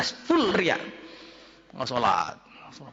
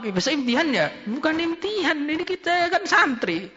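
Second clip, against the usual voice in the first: teasing friends voice; 8 semitones higher than usual. Retold or imitated. Friends teasing a studying student.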